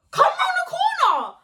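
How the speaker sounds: very high put-on voice; mocking squeal. Teller mocking the challenge, very high mock voice. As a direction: squeak it in mockery.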